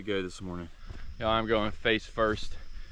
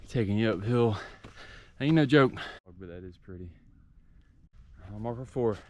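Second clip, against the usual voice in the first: ordinary talk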